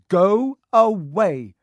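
loud, slow, high exasperated order; fed-up shout, drawn out; angry dismissal. Acted. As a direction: drive out each word to get rid of them. Ron rebuffs Hermione's scolding.

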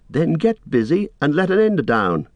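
high-pitched call to hurry; impatient order. A narrator's reading. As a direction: order a friend to get on with it. Frodo calling orders up to Sam.

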